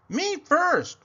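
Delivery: eager yell. Acted. Pig yells in cafeteria.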